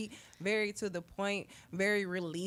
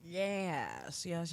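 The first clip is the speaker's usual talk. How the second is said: ordinary delivery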